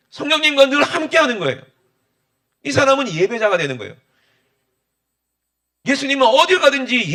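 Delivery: loud, high, fervent sermon delivery; impassioned preaching. Acted. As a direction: preach it out to the whole congregation. Preaching at full force. (힘차게 외치는 설교)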